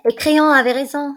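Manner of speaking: a loud, raw burst of despair; anguished outcry. Acted. Antigone crying out as she dictates her farewell letter. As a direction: cry the words out, near breaking.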